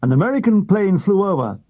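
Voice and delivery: usual speaking voice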